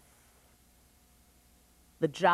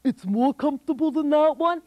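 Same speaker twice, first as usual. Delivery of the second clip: dim giant; 5 semitones higher, 4 dB louder than usual. Acted.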